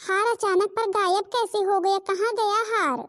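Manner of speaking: put-on distressed young daughter-in-law; panicked young bride. Daughter-in-law's missing necklace panic.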